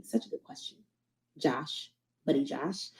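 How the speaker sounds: under-breath whisper to herself; soft whispered aside reading a comment. Real, not acted. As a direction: murmur it off-mic like a private note.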